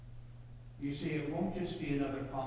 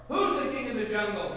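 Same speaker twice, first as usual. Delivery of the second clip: loud lion's challenge, pitched up; booming, raised demand; roaring lion; 4 semitones higher, 7 dB louder than usual. A narrator's reading. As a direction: roar it at a smaller animal. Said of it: "he roared to the monkey".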